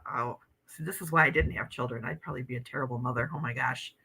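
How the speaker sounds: ordinary talk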